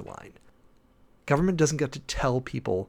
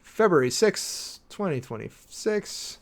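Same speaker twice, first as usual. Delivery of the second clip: dictating while typing; 5 semitones higher, slower than usual. Real, not acted. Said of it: "just gonna go ahead and put in now".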